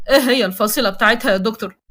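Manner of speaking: plain reading voice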